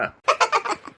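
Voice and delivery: short high laugh; burst of laughter. Acted. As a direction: laugh out at the joke. Laughs at the cost joke. (சிறிய சிரிப்பு)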